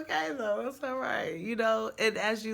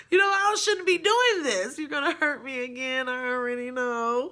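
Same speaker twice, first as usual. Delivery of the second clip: high, loud anxious protest; mock-distressed outburst, much louder; upset pleading; 6 semitones higher, 8 dB louder than usual. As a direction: plead with him, rising panic. Her own retold inner plea.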